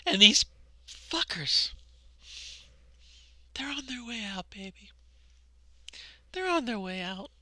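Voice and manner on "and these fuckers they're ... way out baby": breathy conspiratorial whisper. Real, not acted. Telling viewers their opponents are leaving, in a whisper. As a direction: whisper it close to the mic with glee.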